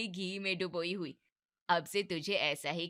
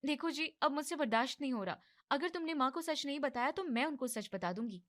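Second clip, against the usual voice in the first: higher, firm ultimatum; fed-up young wife; 7 semitones higher than usual. Acted. Wife warns husband she will tell mother the truth, higher and firm. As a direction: threaten him with the truth.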